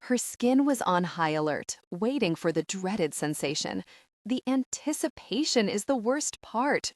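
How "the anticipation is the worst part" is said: mild wail. A narrator's reading. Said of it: "she wailed".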